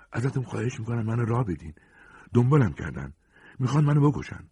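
high, pressing plea; urgent pleading. Acted. Hunted man begging a woman for shelter, high urgent plea. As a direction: beg to be let in.

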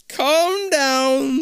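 high, slow, eerie disembodied voice; hollow echoing voice. Acted. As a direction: echo it out like a ghost in the walls. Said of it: "a hollow voice".

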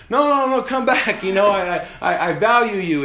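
high, loud mock pleading; pleading man. Man calling after the partner who walked away, mock pleading. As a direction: beg her to come back, hammed up. Man begging her to return.

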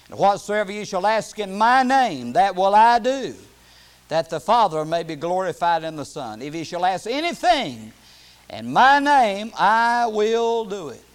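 loud, emphatic Bible reading; scripture proclamation. Real, not acted. Preacher reading Jesus' promise to the church, emphatic proclamation. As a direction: proclaim the promise, slowing and swelling at the end.

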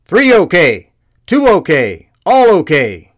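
high, loud rapid call-outs; shouted sound-off. A narrator's reading. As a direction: yell each count down the line. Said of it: "initiates the final sequence".

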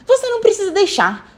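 high-pitched challenging retort; defiant. A narrator's reading. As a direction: defy the grown-up. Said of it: "disse com um olhar desafiador". (resposta desafiadora e aguda)

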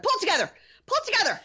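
high, put-on urging voice; mock self-pep talk. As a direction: urge herself to hold it together. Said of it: "I was like".

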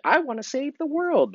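put-on a high little-kid voice; high, childlike "I wanna save the world"; childlike dream voice. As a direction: squeak it like an excited child. Voicing kids' dream.

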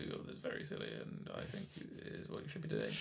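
very low, very soft English murmur; mock English intellectual, hushed and deep; Christopher Hitchens. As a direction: mumble it low and quick, barely audible. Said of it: "Christopher Hitchens on any American T V show".

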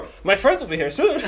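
put-on high, loud drunken reassurance; losing it, hammed up with a laugh; mock deluded hope. Drunk self losing it over napkin.

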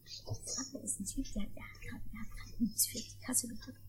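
hushed whisper about the man at the till. Acted. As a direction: whisper it so the man in line can't hear. Gossiping at the checkout.

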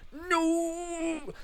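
long, very high anguished yell; angry cry. A narrator's reading. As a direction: howl it in disbelief. Sam yells at slashed tyres.

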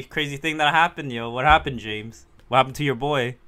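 ordinary talk

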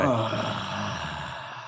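heavy, drawn-out exhale; slow deflated sigh; long weary sigh. Real, not acted. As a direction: let out a big fed-up sigh.

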